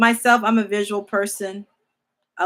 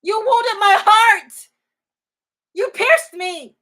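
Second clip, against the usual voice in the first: anguished cry; 11 semitones higher, 12 dB louder than usual. Acted. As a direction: wail it out as a pierced heart. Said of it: "And yet y'all saying".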